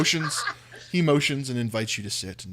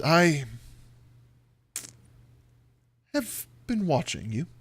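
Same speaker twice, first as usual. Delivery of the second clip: higher, halting count with long pause; Strahd confiding; 4 semitones higher than usual. Acted. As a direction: let it hang after the first word. GM as Strahd, ominous.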